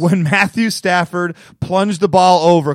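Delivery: ordinary talk, nothing marked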